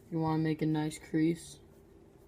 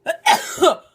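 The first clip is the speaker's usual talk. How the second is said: high, loud triumphant laughing; excited laughing; 13 semitones higher, 13 dB louder than usual. Real, not acted. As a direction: shriek with delight. Sneeze finally comes.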